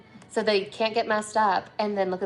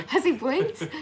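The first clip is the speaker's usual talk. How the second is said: laughing; 7 semitones higher, 3 dB louder, slower than usual. Real, not acted. Laughing at blink joke.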